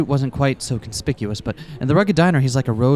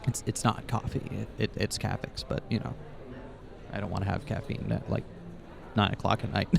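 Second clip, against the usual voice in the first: quiet; 3 semitones lower, 12 dB quieter than usual. Real, not acted. Aside to the other player, quieter.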